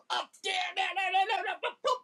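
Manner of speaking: high, stuttering DJ scratch noises; mimicked record scratching. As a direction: repeat the word like a record being cut. Acts out DJ cutting records.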